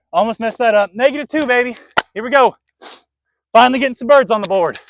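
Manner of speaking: high, loud celebration; excited. Real, not acted. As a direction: hype yourself up. Celebrating birdie.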